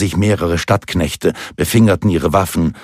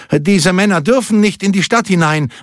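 guard calling out in a high, raised pitch; high-pitched official objection; 11 semitones higher than usual. A narrator's reading. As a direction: call out a protest as a watchman barring entry. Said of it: "rief einer der Wächter".

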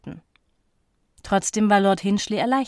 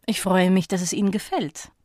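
plain reading voice

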